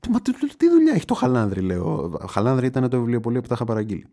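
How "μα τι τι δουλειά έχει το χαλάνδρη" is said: higher, louder disbelieving outburst; can't believe what she hears; outraged. Retold or imitated. Customer protests to the clerk in louder, higher disbelief.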